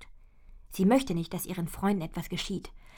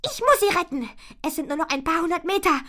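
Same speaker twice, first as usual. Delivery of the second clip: hohe, laute, drängende Mädchenstimme; aufgeregtes Mädchen; 11 semitones higher, 9 dB louder than usual. Acted. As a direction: ein verzweifeltes Feenmädchen, das drängt. Ponyfee fleht im Ballon. (hohe, aufgeregte Mädchenstimme)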